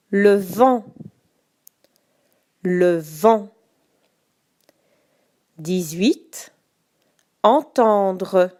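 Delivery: slow, carefully articulated dictation. Shown as a demonstration. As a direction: say each word clearly for spelling. Dictating each word twice.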